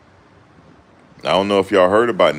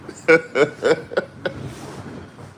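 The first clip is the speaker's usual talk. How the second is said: high breathy laugh; 11 semitones higher than usual. Real, not acted. Laughing at Jimmy's cluelessness.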